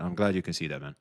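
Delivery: dropped to a soft aside; quiet repeat. Real, not acted. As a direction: say it again half to yourself. Repeats the line under his breath.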